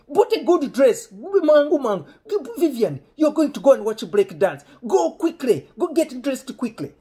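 high, loud mock urgency; imitating bossy dad. Dad hurrying Vivian to dress for the film, high, loud impression. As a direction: order her along in an excitable father's shout.

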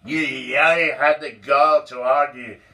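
loud, high furious rant; lost control. Acted. Boss throwing Donald out, furious shouted rant. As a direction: boss losing it, yelling at full volume. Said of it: "he lost his self-control".

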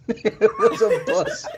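talking through laughter; cracking up mid-sentence; laughing. Real, not acted. Laughing at dumb map.

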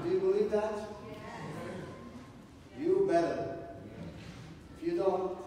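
ordinary delivery

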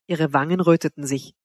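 ordinary delivery, nothing marked

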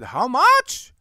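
loud, high burst of disbelief; retold outraged price shock, pitched right up. Customer hears the rug-cleaning price, shocked and loud.

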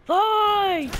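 loud, high mock announcer yell; high shout of fight; shouted announcer call. Echoes the game announcer.

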